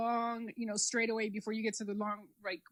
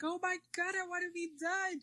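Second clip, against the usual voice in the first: high, hushed, weary whine; worn-out plea; 8 semitones higher, 4 dB quieter than usual. Retold or imitated. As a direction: beg to be finished, high and soft. Exhausted runner's inner voice.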